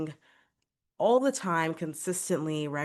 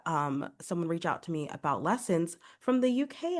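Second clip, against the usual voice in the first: usual speaking voice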